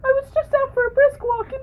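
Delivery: high-pitched hog voice. Acted.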